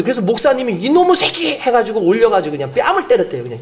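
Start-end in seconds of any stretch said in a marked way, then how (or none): 0.7-1.6 high, sharp mock rebuke; angry scolding. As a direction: scold the boys in mock anger. Pastor scolding smoking kids. (담배 피운 아이들을 꾸짖는 목사님의 호통)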